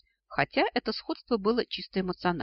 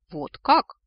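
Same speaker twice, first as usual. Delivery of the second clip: loud, higher snort of disbelief; 4 semitones higher, 9 dB louder than usual. A narrator's reading. Dragon reacts to the heroine's claim, snorting. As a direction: snort it out, loudly. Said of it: "фыркнул Аргел".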